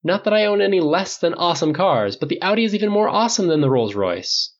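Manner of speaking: lightly voiced Tony. A narrator's reading. Tony boasting about cars.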